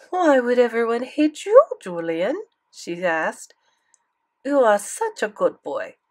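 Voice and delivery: French grandmother, doting; French accent. Acted. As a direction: reassure him in a French accent. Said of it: "thick French accent".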